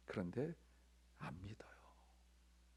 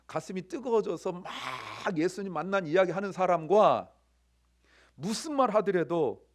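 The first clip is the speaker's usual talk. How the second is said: higher, louder sermon delivery; impassioned preaching; 4 semitones higher, 6 dB louder than usual. Real, not acted. Preacher urging congregation to feel the women's excitement, impassioned. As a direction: drive each point home with raised voice.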